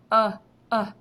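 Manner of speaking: "uh" pulled out as a bare short vowel, firm; isolated short u. Shown as a demonstration. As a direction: hit the short "uh" sound by itself. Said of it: "I think uh would be a short vowel".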